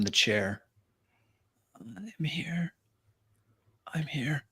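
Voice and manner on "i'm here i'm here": faint, dry whisper; weak raspy whisper. Acted. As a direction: barely get the words out. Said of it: "A weak, dry, raspy voice".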